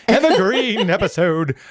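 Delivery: slow, stagey Dickensian English on Evergreen episode; Dickensian accent. Acted. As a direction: put on the old Victorian accent, slowly. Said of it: "as I go back into Dickensian form".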